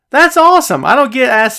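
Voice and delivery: light up at the surprise; real happy surprise; delighted. Real, not acted. Surprised by shout-out request.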